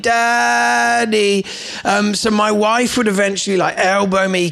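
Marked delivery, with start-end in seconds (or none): 0.0-1.5 put-on high, drawn-out little child's call of "Daddy"; small child calling for Dad in the dark; child calling at night. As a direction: call out like a sleepy kid from the next room. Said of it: "But the voice continued".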